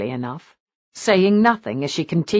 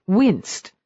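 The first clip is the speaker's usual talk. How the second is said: usual speaking voice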